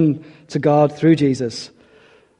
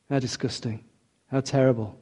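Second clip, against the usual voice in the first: mock disgust; 3 semitones lower, 10 dB quieter than usual. Mocking the Pharisees' disgust.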